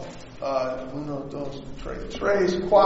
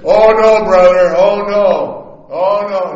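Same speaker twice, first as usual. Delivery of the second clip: loud, booming wrestler protest; Hulk Hogan shouting; 11 dB louder than usual. Retold or imitated. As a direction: bellow it like the Hulkster. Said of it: "And he said".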